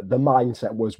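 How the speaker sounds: ordinary talk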